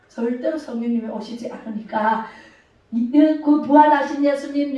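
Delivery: normal talking voice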